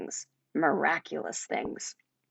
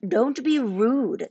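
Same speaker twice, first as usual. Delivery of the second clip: slow, higher reproach from a bird; scolding owl; 4 semitones higher, much slower than usual. A narrator's reading. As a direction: scold him like a prim little owl. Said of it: "the owl scolded".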